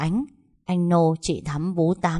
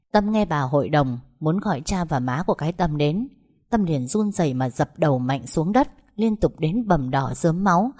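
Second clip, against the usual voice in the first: ordinary delivery, nothing marked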